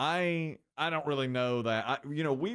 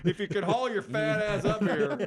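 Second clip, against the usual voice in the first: mock loud call-out; 11 semitones higher, 6 dB louder than usual. Mock counter call to customer.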